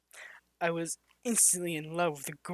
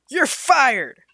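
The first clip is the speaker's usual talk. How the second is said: high, loud furious yell; 9 semitones higher, 14 dB louder than usual. Acted. Manager firing the barista, yelling in fury. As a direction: scream it at the employee. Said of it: "yelled in fury".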